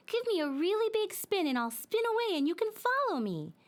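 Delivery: high, eager little toy voice; a toy coaxing a big spin; eager dreidel voice. Acted. Dreidel urges Talia to spin him, high and eager.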